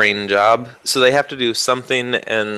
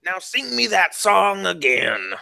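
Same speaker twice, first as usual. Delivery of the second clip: slow, raised-pitch request; slow high character voice; 7 semitones higher than usual. Acted. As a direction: coax the bird slowly. Shoemaker to bird.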